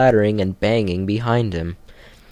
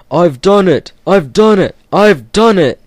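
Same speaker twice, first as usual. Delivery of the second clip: much higher, loud triumphant crowing; proudly; 9 semitones higher, 8 dB louder than usual. Acted. Gordon reaching the hilltop, loud proud triumph. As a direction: crow it at the top of the hill. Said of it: "he said proudly".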